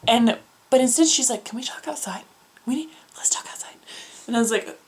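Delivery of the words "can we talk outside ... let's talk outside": whispered, pressing; urgent whisper. Retold or imitated. Friend whispering to Carly in the tiny reception, urgent whisper. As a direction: hush it, you need to get out now.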